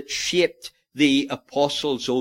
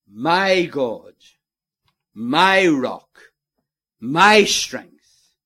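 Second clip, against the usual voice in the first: "my God, my rock, my strength" building louder; rising preaching; 6 dB louder, much slower than usual. Real, not acted. Preacher rising in fervour before the congregation, growing louder. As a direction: build each phrase louder than the last.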